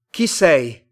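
loud, high demand; challenging question. A narrator's reading. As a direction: call it out sharply to a stranger. Tomás challenges the unknown stranger.

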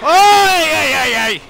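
loud, high call for attention. Real, not acted. Player calls loudly to a patient below. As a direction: shout to get his attention.

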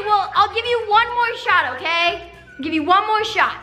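ordinary talk, nothing marked

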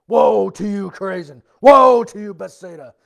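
loud angry shout of woe; angry movie Jesus. Retold or imitated. Mocking how movie Jesus angrily shouts the woes. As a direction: bellow the woes like a film Jesus. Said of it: "he's always really angry when he says these woes".

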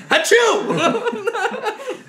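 loud high fake sneeze; mock sneeze. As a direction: shout a hammy sneeze. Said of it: "he just screamed like fucking".

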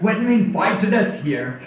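ordinary talk

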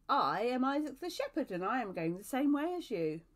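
loud, firm self-introduction; hearty declaration. A narrator's reading. Shepherd offering his hand to Elizabeth, declaring loudly. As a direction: announce yourself in a big, loud voice.

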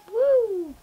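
a quick high cheer; excited woo. Real, not acted. Kid celebrating new string lights, happy woo. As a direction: cheer with delight.

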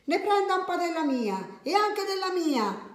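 very high-pitched, gleeful little voice; eager child's offer. Acted. Lucia offers the thief her cake, eager high voice. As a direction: pipe up high, stretching the end.

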